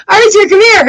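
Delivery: high coaxing call to the dog. Retold or imitated. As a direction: coax the dog out, sing-song and high. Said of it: "so I'm like".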